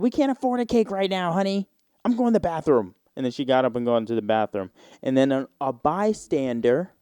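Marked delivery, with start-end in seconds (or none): 0.0-2.9 higher, gentle mom voice; lightly voiced mother. Mother telling her son they can't afford cake, lightly voiced. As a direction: soothe the kid like a tired mom.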